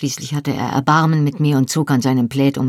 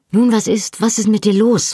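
higher, pressing impatient question; 5 semitones higher, 4 dB louder than usual. A narrator's reading. Claire presses the silent Jamie for an answer, impatiently. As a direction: demand an answer, losing patience. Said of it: "fragte ich ungeduldig".

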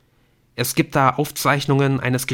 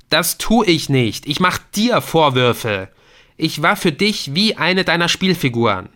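higher, louder furious son; bitter reproach; 7 semitones higher, 6 dB louder than usual. Acted. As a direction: throw the blame back at him. Adrian reproaches his father. (bitterer, lauter Vorwurf)

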